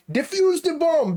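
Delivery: put-on high, loud Indian-accented callout. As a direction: shout it in a thick Indian accent. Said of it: "I can't do an Indian accent".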